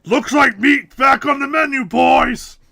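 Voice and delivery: loud, high orc bellow; orc shout. Pretend.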